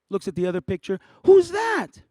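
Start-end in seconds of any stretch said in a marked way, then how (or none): 1.2-2.0 high, loud, puzzled "Who's that?"; high loud friend's question; curious friend. Retold or imitated. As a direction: play the baffled schoolfriend pointing at a photo. Chelsea asking about photos.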